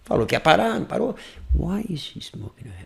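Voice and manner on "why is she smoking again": hushed, private murmur; intimate voice. Shown as a demonstration. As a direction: say it very softly to yourself. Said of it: "Voz íntima é uma". (voz íntima, bem baixinho)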